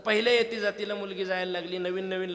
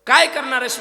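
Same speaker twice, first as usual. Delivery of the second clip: ringing question; 7 semitones higher, 15 dB louder than usual. Retold or imitated. Rama questions Sugriva.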